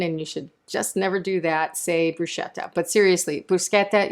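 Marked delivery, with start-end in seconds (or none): 2.2-2.7 bruschetta said with sh, broo-SHET-ta; mispronunciation. Shown as a demonstration. Cook on how to annoy an Italian, mispronounced bruschetta with sh. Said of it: "if you want to annoy an Italian". 3.5-4.1 bruschetta with a hard k, broo-SKET-ta; correct pronunciation. Shown as a demonstration. Cook seriously correcting viewers, proper bruschetta with hard k. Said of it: "is the correct pronunciation".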